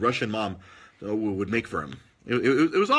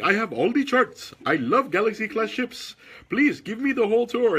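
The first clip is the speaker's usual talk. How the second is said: mock Russian dad; 8 semitones higher than usual. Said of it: "He's like".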